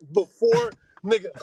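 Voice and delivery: usual speaking voice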